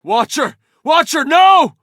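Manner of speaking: high, loud cry of horror; alarmed shout. Acted. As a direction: scream out to a falling leader. Crying out to falling leader.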